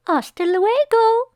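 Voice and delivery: high, slow neighbor calling down the stairs; calling goodbye. Acted. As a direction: sing out a goodbye from the landing, high and drawn out. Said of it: "called Missus Ramos from the landing".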